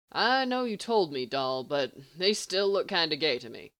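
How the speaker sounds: ordinary delivery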